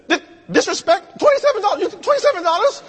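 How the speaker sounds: very high, fast comic disbelief; sputter, then rattle it off high; hammed-up squeal of indignation; outraged cashier.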